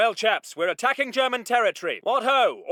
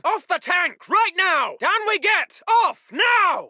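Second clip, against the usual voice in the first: commanding British officer, voice raised; urgent shouted order. Acted. Officer ordering chaps off the tank, urgent shout. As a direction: order them down at once.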